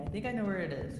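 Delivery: ordinary delivery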